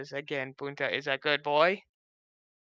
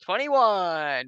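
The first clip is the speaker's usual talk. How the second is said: excited cheer; 4 semitones higher, 6 dB louder than usual. Real, not acted. Nat twenty celebration.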